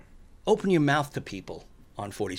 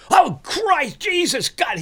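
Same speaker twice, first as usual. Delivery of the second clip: much higher, loud startled exclaiming; alarmed cries; 14 semitones higher, 5 dB louder, much slower than usual. Retold or imitated. Next moviegoer suddenly jolted, loud alarmed cries. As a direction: cry out in shock. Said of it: "all of a sudden goes".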